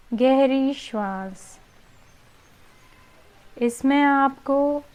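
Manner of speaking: slow yoga nidra guidance, long pauses; guided relaxation. Real, not acted. (धीमी, शांत योग निद्रा निर्देश की आवाज़, ठहर-ठहर कर)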